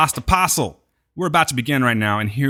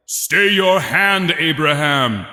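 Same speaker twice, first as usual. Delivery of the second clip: slow, commanding mock divine call; booming voice from heaven, imitated; God's yell; 2 semitones higher, 3 dB louder, much slower than usual. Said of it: "we hear God yell".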